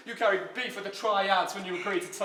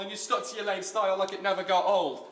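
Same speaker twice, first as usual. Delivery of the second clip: ordinary talk, nothing marked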